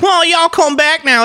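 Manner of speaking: high, twangy Southern mimicry; hammed-up Southern "y'all" imitation. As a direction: sneer it like a fake Southerner. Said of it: "and you're like".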